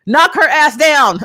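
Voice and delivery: loud, high, drawn-out order; exasperated yell. Real, not acted. Yelling advice at characters.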